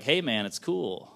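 usual speaking voice

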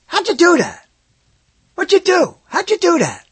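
high, loud astonished questions; astonished asking. Retold or imitated. Audience member to magician, high amazed pestering.

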